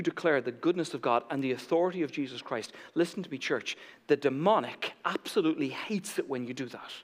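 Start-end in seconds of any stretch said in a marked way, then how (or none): none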